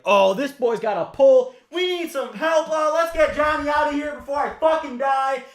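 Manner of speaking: high, panicked mock shout for help. Retelling his panic as Johnny got angry, high mock shouting. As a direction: yell for help like you're about to get hit.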